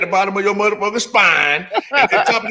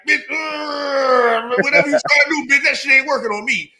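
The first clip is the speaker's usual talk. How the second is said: higher, mock-defiant taunt; defiant, daring retort; 8 semitones higher than usual. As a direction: dare him to try, lightly acted. Defiant standoff with the healer.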